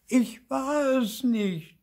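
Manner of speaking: very high, slow, loud wail; little ghost's drawn-out lament; whining. A narrator's reading. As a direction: whine your innocence, drawing out each word. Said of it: "jammerte Zipfel".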